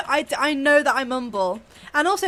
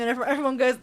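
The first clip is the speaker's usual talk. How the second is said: ordinary talk, nothing marked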